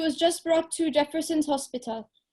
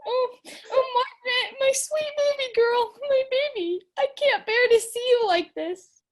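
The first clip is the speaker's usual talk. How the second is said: sobbing; 5 semitones higher than usual. Acted. Mother weeps at comatose daughter.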